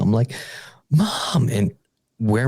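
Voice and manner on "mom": put-on a child calling out for mom, pitched up; retold scream for mom. Retelling a childhood night terror, a high mild mock cry for mom. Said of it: "I'm just screaming out".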